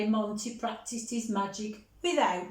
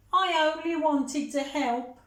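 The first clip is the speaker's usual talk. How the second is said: higher, louder injured protest; hurt little sister; 6 semitones higher, 4 dB louder than usual. Acted. Millie telling her brother she only wanted to help, hurt. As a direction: sound wounded and small.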